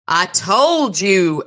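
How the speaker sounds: a hint of menace, drawn out slow; cold and hard. A narrator's reading. Old wizard warns the gunman, slow cold threat. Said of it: "cold and terrible and hard".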